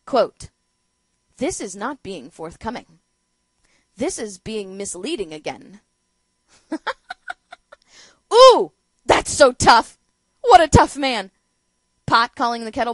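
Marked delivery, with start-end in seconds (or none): 1.4-5.8 deadpan quote, low and soft; understated, sarcastically subdued reading; Paul Ryan's eruption, mocked. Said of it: "here is Paul Ryan's eruption". 6.7-7.5 high, quiet giggle; high-pitched snickering; mocking laugh. Real, not acted. Laughs at the feeble quote. 8.3-11.3 loud, sarcastic gushing; exaggerated, derisive admiration; mock awe.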